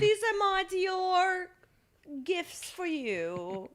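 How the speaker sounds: high, slow mock British royal voice; bougie queenly accent. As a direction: stretch it out like the queen, in fake British. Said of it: "Like the queen".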